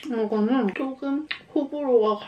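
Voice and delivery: speaking after a bite; talking while chewing. Real, not acted. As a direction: mouth partly full. Seen 5: Bites just before; cheeks and lips show chewing early in the words.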